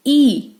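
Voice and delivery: ई said as long 'ee', as in beat; long ई, the vowel of 'seat'; long E sound. Shown as a demonstration. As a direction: give ई the letter name of E. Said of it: "ई इसका long sound है".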